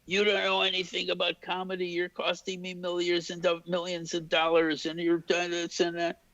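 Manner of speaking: high, monotone mock tirade; Mel Brooks yelling. Director gets in cameraman's face each morning, high mock yelling. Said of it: "and yell at me".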